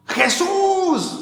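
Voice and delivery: high, drawn out call to Jesus; crying out. Retold or imitated. Homeless man crying to heaven at 3 am, high cry. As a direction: cry the name up to the sky. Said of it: "a gritar".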